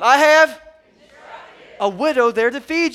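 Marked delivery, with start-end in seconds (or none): 0.0-0.6 louder call and response. Real, not acted. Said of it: "one more time".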